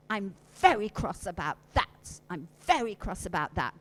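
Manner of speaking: cross. Shown as a demonstration. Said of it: "turn to each other and say".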